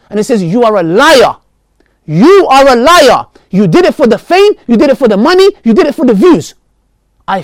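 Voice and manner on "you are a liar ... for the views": stern divine rebuke. Acted. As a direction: pronounce judgment like God condemning a hypocrite. God's rebuke voiced in story.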